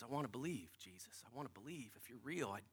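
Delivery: very soft, low, hushed plea; silent inner plea. Retold or imitated. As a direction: retell a private prayer almost under the breath. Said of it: "As I'm walking up there in my head".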